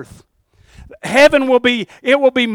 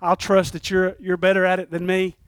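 soft prayer; 5 semitones lower, 9 dB quieter than usual. Pretend. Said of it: "I like to say this".

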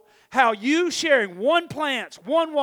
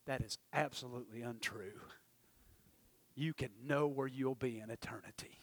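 put-on whispered firm child's voice; quiet, certain child correcting an adult; hushed insistence; 10 semitones lower, 16 dB quieter than usual. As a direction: whisper it like a sure little girl. Retold girl insists, whispered.

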